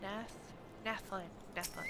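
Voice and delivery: ordinary talk, nothing marked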